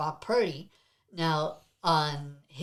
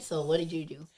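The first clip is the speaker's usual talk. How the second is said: usual speaking voice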